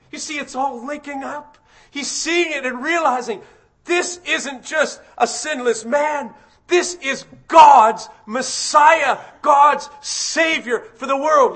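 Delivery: high, loud, driving preaching voice; full-force preaching. Acted. Preacher proclaiming Christ to the congregation at full force. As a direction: preach it with fire.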